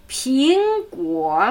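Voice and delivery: careful Mandarin tones on Ping Guo; second and third tone. Shown as a demonstration. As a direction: climb on Ping and sink on Guo. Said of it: "zweiter aufsteigende und dritte hockende Ton".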